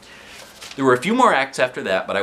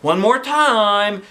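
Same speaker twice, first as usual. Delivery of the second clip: slow, high drawn-out announcer call; Mrs. Lane announcing; 10 semitones higher, 6 dB louder, much slower than usual. A narrator's reading. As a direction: stretch it out like a showhost. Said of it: "she announced".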